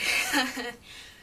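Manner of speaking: short, loud laugh; brief burst of amused laughter. Real, not acted. Vlogger faced with picking a Jamaican dish, laughing. Laughs at a daunting food question.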